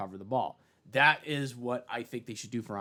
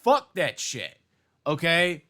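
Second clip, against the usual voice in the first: higher, louder exasperated protest; angry outburst; 9 semitones higher, 5 dB louder, slower than usual. Real, not acted. Vlogger shouts at flag football backers, exasperated. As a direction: blast back at them.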